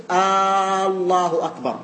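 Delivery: الله opened with a long "aaa"; first wrong form. Shown as a demonstration. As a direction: hold آه long before الله أكبر. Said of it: "الصورة الأولى واضحة".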